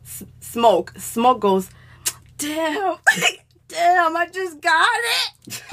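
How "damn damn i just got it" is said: higher, drawn-out complaint; mock protest. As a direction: imitate a friend protesting at being rushed. Retold protest from the weed hogger.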